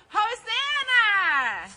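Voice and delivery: long, high, full-volume shout. Real, not acted. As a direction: belt it out as loud as possible. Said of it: "we're gonna shout Hosanna as loud as we can".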